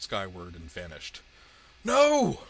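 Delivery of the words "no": high, very loud cry of denial; anguished cry. A narrator's reading. Villain sees his monster destroyed, loud high cry. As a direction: cry out at the top of your voice. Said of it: "Shandor cried".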